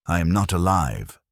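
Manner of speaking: usual speaking voice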